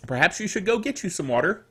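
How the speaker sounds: ordinary talk, nothing marked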